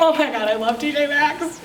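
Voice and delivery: playful mock gush, laughing; excited gushing. Consultant bonding with a guest over her dress, mock excitement. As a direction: gush like you share her favourite store. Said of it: "you just got really excited".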